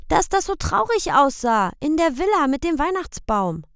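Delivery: put-on high, loud little boy's question; high child's voice; child asking. A narrator's reading. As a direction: ask papa like a worried small boy. Boy Michel explains.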